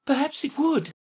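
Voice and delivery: high, hesitant little reply; cautious. A narrator's reading. Alice half agreeing with the Red Queen, cautious. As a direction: concede it carefully in a high voice. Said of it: "Alice replied cautiously".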